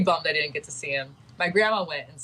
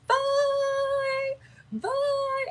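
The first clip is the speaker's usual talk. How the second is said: much higher, sung bye bye; sing-song goodbye. Retold or imitated. Adults with cocktails calling to the other ship, high sung goodbye. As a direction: croon a tipsy farewell. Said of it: "adults are out there with their cocktails like".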